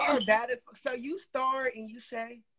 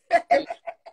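loud burst of laughter; 8 semitones higher, 9 dB louder than usual. Real, not acted. Laughing after compliments.

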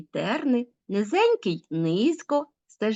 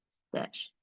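whispered root; 13 dB quieter than usual. Shown as a demonstration. Teacher to pupils, echoing the root "стеж" in a whisper. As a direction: whisper "стеж" softly, almost no voice.

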